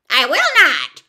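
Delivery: loud, high witch voice; loud, defiant witch; witch's retort. A narrator's reading. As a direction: fire back a defiant refusal as the wicked witch. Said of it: "retorted the witch".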